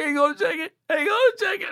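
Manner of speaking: high, strained voice of a man fighting tears; choked-up high voice. Retold or imitated. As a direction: squeeze it out through tears. Emotional friend on phone, voice breaking.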